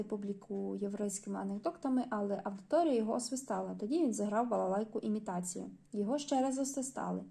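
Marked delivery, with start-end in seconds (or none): none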